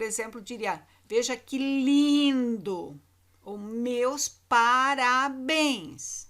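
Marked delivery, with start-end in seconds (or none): 1.0-3.0 slow, drawn-out sarcastic lindo; ironic intonation. Shown as a demonstration. Teacher shows students how irony sounds, slow and sarcastic. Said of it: "vêm carregadas de uma entonação diferenciada". 3.6-6.3 very slow, drawn-out mock praise; sarcastic congratulations. Shown as a demonstration. Teacher demonstrates ironic tone to the class, very slow and sarcastic. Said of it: "vêm carregadas de uma entonação diferenciada".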